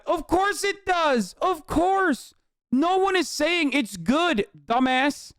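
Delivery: high, drawn-out exasperation; exasperated retort. Real, not acted. Streamer answering an obvious chat comment, exasperated retort. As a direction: stretch it out slowly at a high pitch, fed up.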